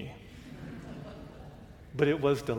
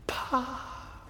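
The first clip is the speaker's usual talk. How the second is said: quiet callback pa; 9 semitones higher, 8 dB quieter than usual. Retold or imitated. Callback joke ending sermon.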